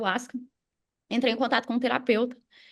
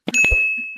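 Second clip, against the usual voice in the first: short laugh; 5 dB louder than usual. Real, not acted. Laughs at own realisation.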